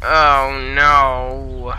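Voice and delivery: sudden loud oh no; loud dismayed cry. Real, not acted. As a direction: blurt it out in alarm. Mistake in build, dismay.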